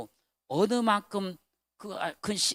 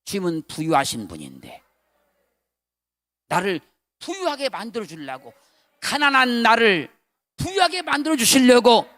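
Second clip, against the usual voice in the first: loud, high preacher's cry; fervent preaching; 5 semitones higher, 12 dB louder than usual. Acted. Pastor retelling his revelation to church, loud fervent preaching. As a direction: shout the revelation with fervor.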